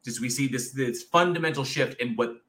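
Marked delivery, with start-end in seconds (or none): none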